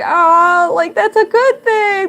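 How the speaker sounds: high, loud baffled reassurance; imitated amused boyfriend. Boyfriend puzzled by her happy tears, imitated loud amused reassurance. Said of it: "he started laughing. He's like".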